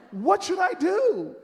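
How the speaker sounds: high, loud, stretched-out plea; exasperated demand. Retold or imitated. Demanding Miss Jones's answer, loudly exasperated. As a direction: cry it out impatiently.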